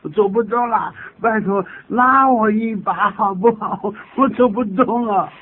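high, loud, hammed-up plea of exhaustion; acted exhausted panting. Worn-out walker begging a companion for a pull, exhausted. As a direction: beg for a hand as if too winded to walk. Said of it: "这个气喘如猪".

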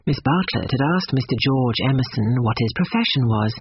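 ordinary delivery

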